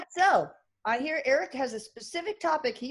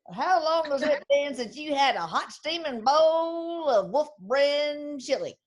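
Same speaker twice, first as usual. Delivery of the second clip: slow, higher Texas drawl of a TV ad; Texas commercial voice; 5 semitones higher, 4 dB louder, slower than usual. Retold or imitated. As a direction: sell the chili like an old Texas ad, slow. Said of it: "he always made me say it".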